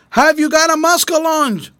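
high-pitched megaphone-style holler; shouting. A narrator's reading. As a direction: shout it across the water to another boat. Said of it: "Miss Susie shouted".

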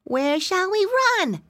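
high, slow little pig. Acted. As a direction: play a small timid piglet. Pig character in story.

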